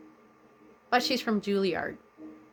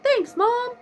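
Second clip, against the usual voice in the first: much higher, louder little thank-you; son's thanks; 7 dB louder than usual. Retold or imitated. Son Tyler thanking his mom in the dream, high and loud. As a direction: pipe up grateful as the son. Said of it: "He's like".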